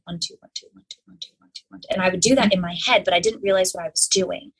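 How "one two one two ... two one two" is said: hushed, near-whispered counting; very soft, rhythmic inner counting; imitated clicking count. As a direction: count under your breath like ceiling fan clicks. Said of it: "I would hear the clicks and it would go like".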